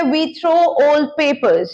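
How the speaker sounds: each word drawn out slowly for learners; slow model sentence. Shown as a demonstration. Teacher to students, slow clear model sentence. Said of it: "आगे बढ़ते हैं".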